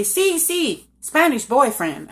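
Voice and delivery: high, eager mock agreement; playing along. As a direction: agree with a knowing, drawn-out lilt. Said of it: "And I'd say".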